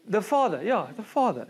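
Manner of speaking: playful mock South African accent; imitated South African accent. Teasing Joshua's attempt at the accent, imitated South African accent. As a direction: say "Father" in an exaggerated South African way. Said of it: "in a South African accent".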